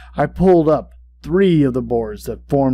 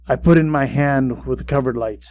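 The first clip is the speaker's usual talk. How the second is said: plain reading voice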